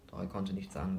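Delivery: plain reading voice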